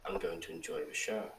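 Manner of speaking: low, soft, lightly voiced villain; menacing telepathic message. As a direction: send it quietly into their head. Villain's message heard in head.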